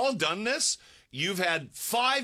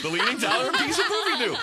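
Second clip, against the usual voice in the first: laughing; 7 semitones higher, 4 dB louder, faster than usual. Real, not acted. Repeating the hairstyle name, laughing.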